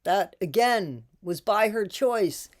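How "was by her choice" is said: ordinary delivery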